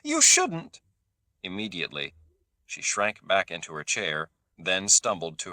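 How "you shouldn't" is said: sudden high cry; frightened sister bursts out at the table. A narrator's reading. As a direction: blurt it out in alarm. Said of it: "Teresa burst out".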